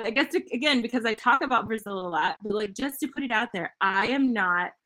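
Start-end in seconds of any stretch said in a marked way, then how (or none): none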